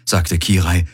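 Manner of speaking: usual speaking voice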